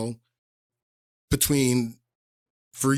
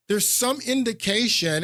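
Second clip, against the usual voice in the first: mocking emphasis; 8 semitones higher than usual. Real, not acted. Mocking McCain's claim.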